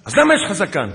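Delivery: high, loud imitated demand; loud challenge. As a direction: fire the question like an accusing sergeant. Sergeant's loud challenge, retold.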